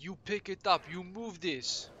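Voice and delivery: high-pitched mock Slavic accent, giving orders; thick accent. Kitchen boss orders dishwasher to move flour, thick accent. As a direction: command it in a thick Eastern European accent. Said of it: "thick accent".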